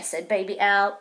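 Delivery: ordinary delivery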